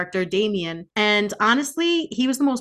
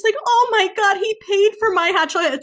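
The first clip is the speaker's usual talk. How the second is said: much higher, half-crying mock swoon; swooning heroine; 11 semitones higher, 3 dB louder than usual. Mocking the heroine over a hot chocolate, high mock swoon. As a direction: gush it like a lovestruck girl. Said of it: "she's like".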